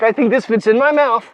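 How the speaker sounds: loud, playful dog's voice; lightly voiced, pleased dog; voicing the dog. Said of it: "He's like".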